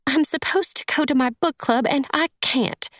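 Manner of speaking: high, upset stepmother voice; acted sniffly distress in a high voice; tearful woman. A narrator's reading. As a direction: wail it lightly, pitched well up. Sniffling stepmother explains her tears.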